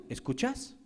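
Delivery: "¿Escuchas?" turned back softly with a rising tune; quiet echo. Retold or imitated. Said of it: "demanda algo que no hace".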